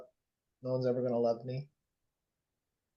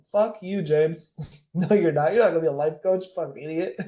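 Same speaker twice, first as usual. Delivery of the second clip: mock angry younger self; 3 semitones higher, 5 dB louder than usual. Younger self yelling at future life coach, mock angry. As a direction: yell it at your future self, half laughing. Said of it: "I would have told him".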